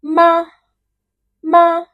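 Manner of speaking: MA high, loud and level; first tone. Shown as a demonstration. Teacher reviewing four tones, high level "Ma". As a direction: hold "Ma" straight on a high pitch. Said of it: "let's have the four tones together".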